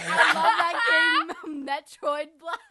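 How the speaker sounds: high, loud gushing broken by laughter; mock enthusiasm through laughter; laughing self-quote. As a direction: gush loudly, then crack up. Quotes herself while cracking up.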